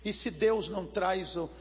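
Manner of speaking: normal talking voice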